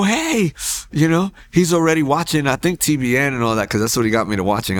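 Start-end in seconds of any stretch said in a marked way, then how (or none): 0.0-0.5 high, eager call-out; retold high-pitched greeting across a distance. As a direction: hail him excitedly in a high voice. Retold calling out to the other inmate.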